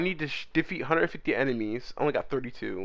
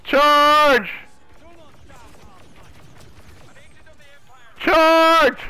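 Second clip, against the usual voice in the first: high, loud charging yell; rally the troops into battle; battle cry; 12 semitones higher, 6 dB louder than usual. Acted.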